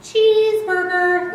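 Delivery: high, loud, slow "Cheeseburger" birdcall; chickadee spring song imitation. Shown as a demonstration. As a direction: belt "Cheeseburger" like a bird singing. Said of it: "he follows it with his spring song".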